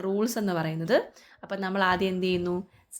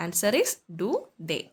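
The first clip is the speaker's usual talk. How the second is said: slow answer; much slower than usual. Shown as a demonstration. Teacher announces answer slowly.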